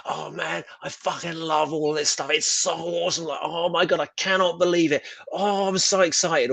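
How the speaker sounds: mock a writer who can't believe his luck; gushing fanboy writer. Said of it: "I'm on there going".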